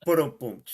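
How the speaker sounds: laughing drum sound; rimshot. Real, not acted. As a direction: mimic a comedy rimshot. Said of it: "He's here all week".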